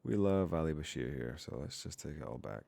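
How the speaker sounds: usual speaking voice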